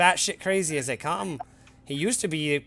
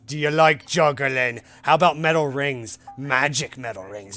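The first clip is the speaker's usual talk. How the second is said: louder, slow carnival-barker juggler voice; showy juggler; 6 dB louder, slower than usual. Acted. Juggler pitches his act to the adventurers, slow and loud. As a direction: sell the trick like a street performer.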